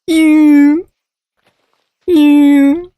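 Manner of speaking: high squeaky ew-ew imitation of a snoring cat; tiny high snore noises mimicking the cat; imitated cat snore. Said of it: "I just hear this little".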